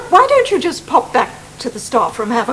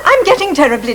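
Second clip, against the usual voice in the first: ordinary talk, nothing marked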